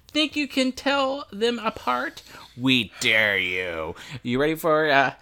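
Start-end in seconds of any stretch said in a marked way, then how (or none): 0.1-4.0 slow dramatic announcer read. Acted. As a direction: read it like a trailer voiceover. Reads box warning as announcer.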